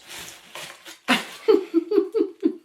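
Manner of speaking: long, high-pitched giggle; real giggle. Real, not acted. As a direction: break into a run of high giggles. Laughs mid-praise of the gifts.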